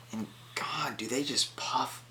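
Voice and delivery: hushed whisper of awe. Real, not acted. Whispering in awe as the snake puffs up. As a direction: breathe it out in wonder.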